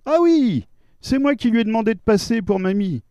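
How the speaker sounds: lightly voiced cheerful son. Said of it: "Je vous le mets en scène".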